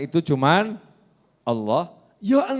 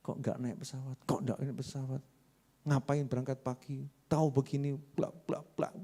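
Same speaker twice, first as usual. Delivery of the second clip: grumbling passenger; 3 semitones lower, 13 dB quieter than usual. Retold or imitated.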